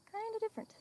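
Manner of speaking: playful falsetto repeat of kinda different; mock high echo. Shown as a demonstration. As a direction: repeat the words in a silly high voice. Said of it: "Like I said, he's kinda different".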